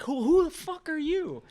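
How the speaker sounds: loud, high, drawn-out mock outrage; belligerent. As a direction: imitate a belligerent woman confronting a stranger. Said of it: "belligerently, like, getting worse".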